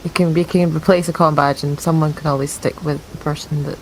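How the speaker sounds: ordinary talk, nothing marked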